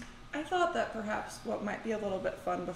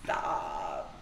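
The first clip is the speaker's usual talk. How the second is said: deep mock stop. Mimics the refusal.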